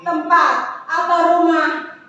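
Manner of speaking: loud preaching to the church, slowing on the question. Acted. Preacher proclaiming the verse to the congregation, loud and fervent. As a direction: proclaim the verse at full force, ending on a slow loud question.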